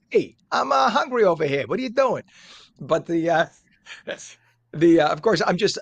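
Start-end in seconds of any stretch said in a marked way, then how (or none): 0.1-2.2 high, loud, hammed-up character accent; exaggerated cartoonish accent, pitched way up; Rocco's put-on accent. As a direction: call out in a playful put-on accent. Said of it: "I'm just assigning him that accent".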